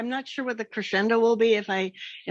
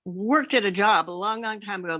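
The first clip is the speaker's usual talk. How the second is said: ordinary talk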